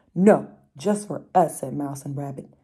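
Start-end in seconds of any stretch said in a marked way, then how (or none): 0.1-1.6 louder, flat-out no; firm group refusal. Acted. Mouse and rabbit refuse hedgehog, louder. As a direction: close ranks against the newcomer.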